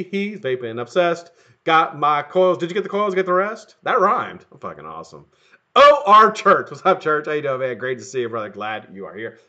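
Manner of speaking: usual speaking voice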